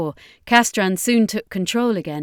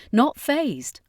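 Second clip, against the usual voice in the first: ordinary delivery, nothing marked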